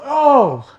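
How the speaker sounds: high shocked "oh" cry; sudden shocked cry. Retold or imitated. Narrator reliving his friend falling after gunshots, high shocked cry. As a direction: cry out as your friend falls.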